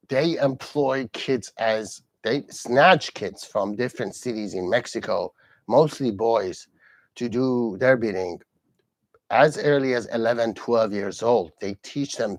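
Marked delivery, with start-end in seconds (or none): none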